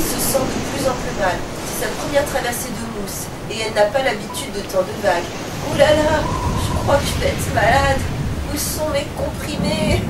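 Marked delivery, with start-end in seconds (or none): 5.7-10.0 moaning. Acted. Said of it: "gémit-elle".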